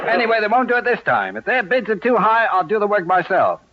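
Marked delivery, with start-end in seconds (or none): none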